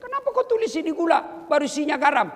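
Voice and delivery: high, loud indignant complaint; husband's mock outraged complaint; complaining. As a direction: protest hotly about the salt. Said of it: "Saya pergi komplain".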